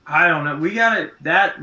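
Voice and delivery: ordinary talk, nothing marked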